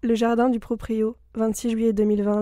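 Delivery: ordinary talk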